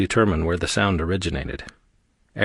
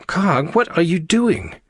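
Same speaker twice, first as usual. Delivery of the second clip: high, slow alarmed call; pitched-up, startled shout; calling after someone; 11 semitones higher, 3 dB louder, slower than usual. A narrator's reading. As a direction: call out in bewilderment. Calling after fleeing Karg.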